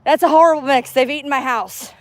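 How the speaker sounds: pitched well up, sweet and friendly; friendly stranger chat. Real, not acted. Greeting a dog owner at the park, high friendly voice. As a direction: lift the voice high to charm a passer-by.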